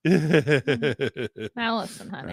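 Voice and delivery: a touch of creepy seduction; creepy come-on. Acted. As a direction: lean in like a creep. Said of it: "that was kind of a creepy one".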